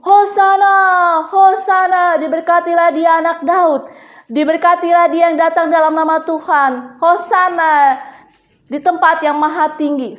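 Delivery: long-drawn, loud Hosana cheers; high, loud acclaiming cry, easing toward the end; crowd shouting. Acted. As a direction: shout praise for the whole crowd. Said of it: "semua berteriak lagi".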